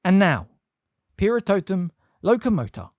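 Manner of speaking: loud commanding incantation; crying out a spell. A narrator's reading. McGonagall casts the spell aloud, loud and commanding. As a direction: call the spell with force. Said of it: "cried Professor McGonagall".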